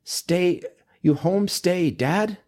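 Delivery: halting, high mock-German accent; feigned German accent. Old lady asks a dad with his baby, thick German accent. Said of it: "like, really thick European accent".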